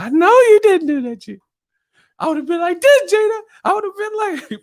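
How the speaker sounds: high falsetto impression, loud at the start; mock-indignant squeal; Mama Payne. Mama Payne telling Gina off, high falsetto. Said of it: "when he played Mama Payne".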